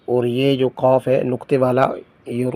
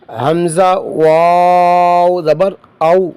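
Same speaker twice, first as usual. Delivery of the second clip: slow, sing-song spelling chant; Qaida recitation drill; 4 semitones higher, 4 dB louder, much slower than usual. Shown as a demonstration. Teacher drilling pupils through Qaida spelling, slow sing-song chant. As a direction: call out each syllable slowly for pupils to repeat.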